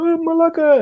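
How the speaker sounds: very high, laughing Greek exclamation; mock game character. Imitating Ezio's Greek guard in the game, high and laughing. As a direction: toss off the curse with a laughing squeak. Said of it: "And he goes".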